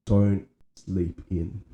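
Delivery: don't sleep in said slowly, deliberately; slow emphasis. Real, not acted. Key advice, slow.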